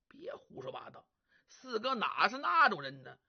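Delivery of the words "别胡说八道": whispered, scolding under the breath; a quick whispered rebuke; hushed whisper. Acted. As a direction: hiss it so no one overhears. Whispering friend hushes the other.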